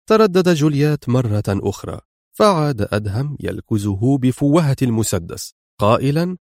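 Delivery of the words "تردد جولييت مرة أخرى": ordinary delivery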